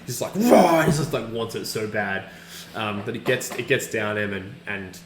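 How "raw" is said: loud, high greedy roar; imitated wild, gulping growl; ravenous roar. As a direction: lunge at it with a roar. Imitates man desperately gulping potion.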